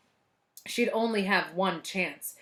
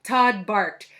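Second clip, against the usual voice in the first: ordinary delivery, nothing marked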